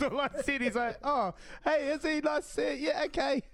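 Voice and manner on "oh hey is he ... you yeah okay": high-pitched put-on voice; mock friend's reply. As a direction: imitate a mate replying, pitched way up. Acting out friend's reply to message.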